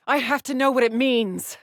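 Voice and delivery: high, forceful demand; desperate insistence. Acted. Explorer confronts whoever is stopping her, desperate insistence. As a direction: insist desperately, pitch pushed right up. Protests at being stopped from learning truth.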